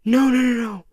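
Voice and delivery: a mother begging in fear; frightened mother. Retold or imitated. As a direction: mom terrified at her child's words, crying out no. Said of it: "the fear that came from her".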